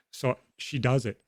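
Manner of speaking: normal talking voice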